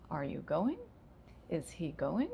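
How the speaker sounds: going rises sharply at the end; rising question. Shown as a demonstration. Teacher modeling a yes-no question rising. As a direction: lift the voice on going. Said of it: "the intonation is gonna rise".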